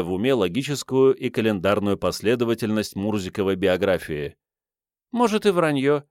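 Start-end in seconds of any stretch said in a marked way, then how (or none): 5.1-6.0 put-on high girlish voice, easy concession; easygoing waif owning up; good-natured girl. A narrator's reading. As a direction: agree without malice, pitched high. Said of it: "беззлобно согласилась предположительная княжна".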